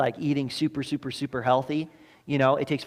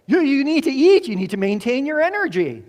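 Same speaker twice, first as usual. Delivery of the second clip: much higher, louder insistent doctor voice; a doctor loudly insisting the sick patient must eat; doctors urging; 12 semitones higher, 8 dB louder than usual. Retold or imitated. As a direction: push the patient hard to eat. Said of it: "what do the doctors say".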